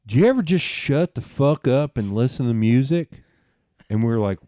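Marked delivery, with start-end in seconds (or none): 0.0-3.2 put-on louder, higher voice of an angry drunk; loud, higher drunk customer snapping; drunk stranger. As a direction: play the cranky drunk barking at the table. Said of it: "And then he said".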